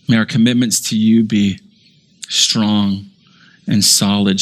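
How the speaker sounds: closing sermon prayer, humble and sincere. Acted. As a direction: pray over the congregation.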